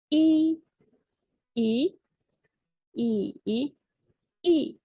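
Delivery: "I" level, rising, dipping, then falling; four tones in turn. Shown as a demonstration. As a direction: Mandarin tone drill on "I". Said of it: "directement les quatre tons".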